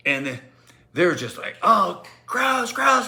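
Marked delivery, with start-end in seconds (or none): 1.6-3.1 high-pitched grossed-out cry; mock kid yelling. Students yelling at him, high mock disgust. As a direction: imitate disgusted kids, pitched way up. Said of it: "and they're yelling at me".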